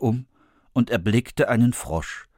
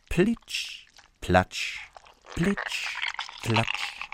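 soft splashy plitsch platsch, dropping quieter; wet footsteps sound; 7 dB quieter, much slower than usual. A narrator's reading. Narrator mimics the frog hopping up the stairs, soft. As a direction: tiptoe the wet steps.

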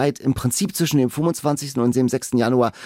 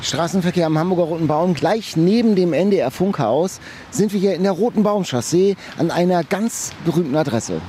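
on-location reportage; 4 semitones higher than usual. Acted.

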